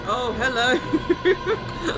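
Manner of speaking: high, loud, drawn-out greeting with a laugh; delighted surprise. Real, not acted. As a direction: greet unexpected guests with real excitement. Surprised by raiders arriving on stream.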